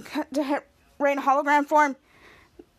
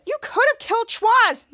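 scolding; 8 semitones higher, 5 dB louder than usual. Retold or imitated. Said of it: "Cut to Charlotte and said".

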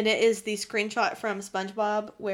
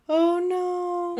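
dismayed oh no; 7 semitones higher, 3 dB louder than usual. Real, not acted. Realizes recording ruined.

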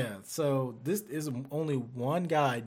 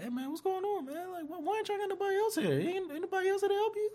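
imitating dad; faster than usual. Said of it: "So my dad's like".

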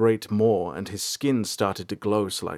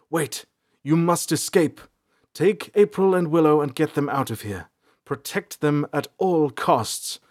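higher, pressing orders; insistent, raised-pitch plea; urgent command; 5 semitones higher than usual. A narrator's reading. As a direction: urge a comrade to escape. Urgent order to flee in fight.

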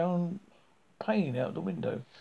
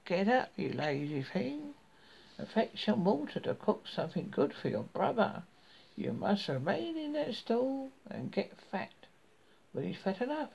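high, scolding witch voice; bossing her like a cruel witch; 6 semitones higher than usual. A narrator's reading. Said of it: "The witch said".

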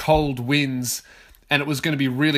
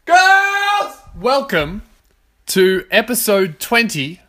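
big shouted announcer opening, drawn out; loud, high, stretched-out podcast intro; yelled show intro; 4 semitones higher, 5 dB louder, slower than usual. Real, not acted. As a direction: yell the welcome like a ring announcer. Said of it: "I just keep yelling".

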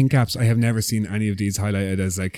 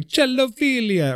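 put-on high, sing-song Italian accent; playful fake Italian lilt; mock Italian accent; 14 semitones higher, slower than usual. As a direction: say it as a hammed-up Italian. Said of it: "doing it in an Italian accent".

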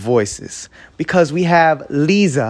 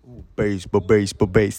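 low, softer mock bass; deep bass voice; 8 semitones lower, 4 dB quieter than usual. Shown as a demonstration. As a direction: sink the voice down, playing a bass singer. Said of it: "either a tenor or a bass".

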